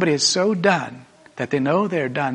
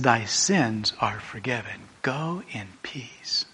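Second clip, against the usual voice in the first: tender forgiveness; 4 semitones lower, 7 dB quieter, slower than usual. Acted. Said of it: "he turns to her and says".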